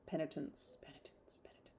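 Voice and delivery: whispered, fading to nothing; eerie whisper. Pretend. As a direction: breathe the word like a spell. Echoing the movie chant.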